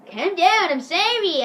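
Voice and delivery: hammed-up aggrieved whine; mock whiny protest. [name] answers the yelling teacher, mock whine.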